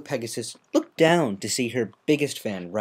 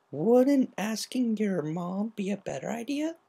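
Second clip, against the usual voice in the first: slow, soft puzzled voice; 11 semitones higher, 4 dB quieter than usual. Acted. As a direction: ask with a frown. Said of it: "Rainbow Dash frowned".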